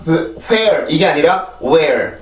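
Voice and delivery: where first with a breathed H, then plain where without H; slow contrast of hwere and were. Shown as a demonstration. Teacher contrasts old and new where. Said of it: "where 이렇게 발음이 되지".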